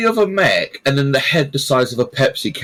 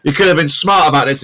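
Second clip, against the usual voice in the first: normal talking voice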